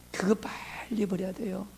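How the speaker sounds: whispered, slow and soft; hushed urging. Real, not acted. Pastor quietly urging the congregation to drop regionalism, whispered. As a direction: lean in and whisper the warning slowly.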